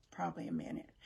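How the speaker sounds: under-the-breath repeat, half to herself; breathy near-whisper correction; whispered aside. Real, not acted. Muttered repeat to self.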